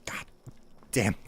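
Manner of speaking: ordinary talk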